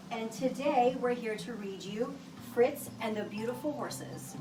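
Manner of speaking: usual speaking voice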